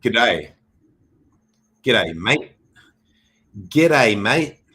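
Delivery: Aussie greeting. Pretend. Mock Aussie greeting to chart.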